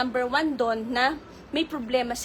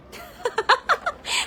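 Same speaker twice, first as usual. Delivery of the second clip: high laugh. Real, not acted. Gossip livestream laughter.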